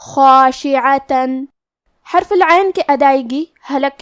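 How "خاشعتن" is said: خاشعتن with a thick, heavy خا and heavy long alif, slow; خاشعتن recited slowly, heavy throaty kha, tanween n clearly sounded. Shown as a demonstration. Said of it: "لہذا ہم خا کو اور مد الف کو بھی بھاری آواز میں پڑھیں گے".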